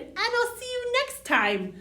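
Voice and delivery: ordinary delivery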